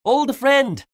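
high, loud joyful hail; happy greeting. A narrator's reading. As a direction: greet a long-lost pal gladly. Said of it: "said Shrek happily".

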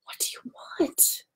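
unvoiced whisper. A narrator's reading. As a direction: whisper so the office won't hear. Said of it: "I whispered".

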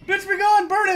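screaming again to banish; furious shout. Real, not acted. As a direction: shout it at full force. Repeated shout.

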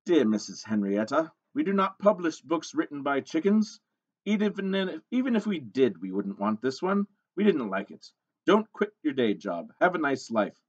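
lightly voiced snooty rejection letter; a hint of a dismissive editor. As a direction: read it out with emphatic, mock-official disdain.

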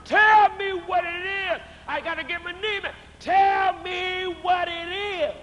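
shouting to the congregation; preacher's shout. Acted. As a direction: demand the answer at full force. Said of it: "demanding answer".

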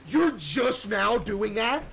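loud, slow, incredulous rising shout; disbelieving outburst; indignant question. Real, not acted. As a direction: call out the lateness in disbelief. Indignant at game character.

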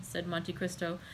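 plain reading voice

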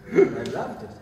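excited. Real, not acted. Repeats laughing.

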